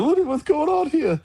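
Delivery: high-pitched, hammed-up tearful wail; mock crying. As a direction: imitate a wrestler crying in bewilderment. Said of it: "like, crying".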